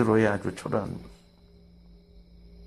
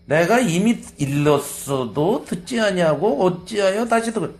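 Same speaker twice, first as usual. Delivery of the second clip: higher, slow, fed-up reply; exasperated retort; 6 semitones higher, slower than usual. Acted. Blind man to the Pharisees, higher and exasperated. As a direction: drag it out like he's tired of repeating. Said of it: "이 소경이 뭐라 했냐면".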